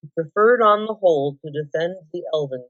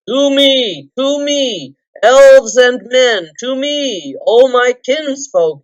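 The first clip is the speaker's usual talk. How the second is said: shouted high and loud, slowing on the last words; rally your allies with a booming call; loud battle cry; 5 semitones higher, 9 dB louder, slower than usual. A narrator's reading. Thorin rallying elves and men in battle, booming cry. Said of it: "he cried".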